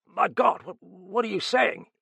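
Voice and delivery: high, loud, stammering outburst; nervous alarm. A narrator's reading. Sir John hearing that Merton is here, alarmed. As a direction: burst out in stunned alarm.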